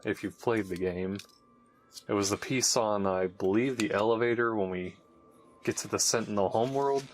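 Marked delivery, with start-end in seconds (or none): none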